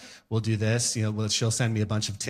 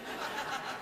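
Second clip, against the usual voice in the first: breathy chuckle; 15 dB quieter than usual. Real, not acted. Stifled laugh.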